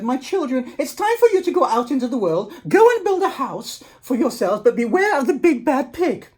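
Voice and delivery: put-on a mother sending her children off with a warning; mother wolf. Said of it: "the mother called ... and said".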